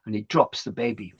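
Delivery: plain reading voice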